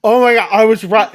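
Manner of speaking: he can't believe it, pitch climbing; excited disbelief. Retold or imitated. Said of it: "And Max is like".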